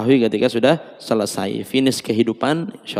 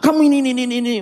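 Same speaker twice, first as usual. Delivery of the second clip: scolding wife; 10 semitones higher, much faster than usual. Acted. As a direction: nag him relentlessly.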